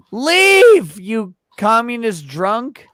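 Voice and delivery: high yelled send-off; shouting. Real, not acted. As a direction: yell him out the door. Shouting friend off stream.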